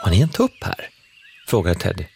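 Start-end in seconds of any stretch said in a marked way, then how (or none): 0.0-0.9 lightly voiced small child, higher; a hint of an excited little kid; curious child. A narrator's reading. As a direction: ask with a child's eager wonder. Child asks about rooster.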